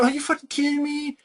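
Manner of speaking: shocked that anyone buys this; high pitched exasperation; incredulous. Retold or imitated. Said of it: "Because I'm like".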